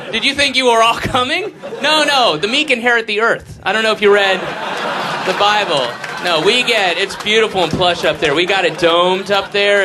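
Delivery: high, loud taunting snob; smug rich snob. Retold or imitated. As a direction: taunt the poor gleefully. Mocking rich elite, loud high.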